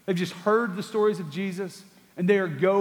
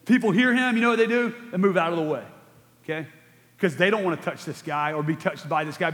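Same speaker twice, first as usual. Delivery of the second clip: ordinary delivery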